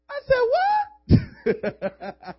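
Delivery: squeaky high startled I said, then a laugh; mock shocked. Preacher reacting to the flirting sister, shocked squeal and laugh. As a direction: jump way up in pitch in disbelief.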